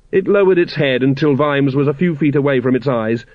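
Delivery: ordinary delivery, nothing marked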